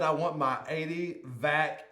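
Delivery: imitating the garbled mouth-guard version, slow; mock mumble. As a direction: parrot the slurred words back, slowly. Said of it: "It sounds like you said".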